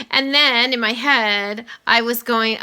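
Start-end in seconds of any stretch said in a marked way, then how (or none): none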